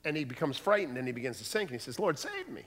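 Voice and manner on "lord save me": mock plea for help. Sinking Peter pleads for rescue.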